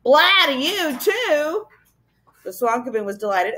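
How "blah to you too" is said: put-on higher, defiant childish yell; yelled back. A narrator's reading. Boy yelling back at a taunting creature. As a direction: throw the taunt back, rising high. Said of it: "yelled back".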